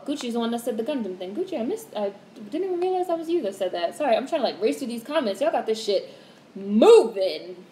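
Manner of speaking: ordinary talk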